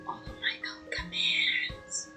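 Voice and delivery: unvoiced self-mocking whisper; whispered aside. Real, not acted. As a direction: whisper it to yourself.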